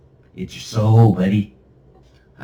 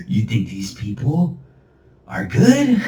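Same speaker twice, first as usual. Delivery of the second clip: hammed-up sneering villain; mocking villain; 2 semitones higher than usual. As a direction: taunt like a gloating demon. Mocking in demon laugh voice.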